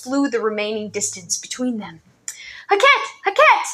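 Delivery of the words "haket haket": high, loud joyful shout of a name; joyful calling. A narrator's reading. Boy running to his friend, shouting joyfully. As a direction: call out to a friend with relief.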